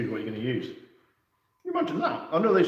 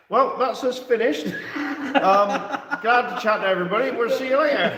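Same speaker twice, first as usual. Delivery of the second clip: high, loud, laughing mock goodbye; giggling farewell; laughing through it; 9 semitones higher, 6 dB louder than usual. Real, not acted. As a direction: fake a sign-off while cracking up.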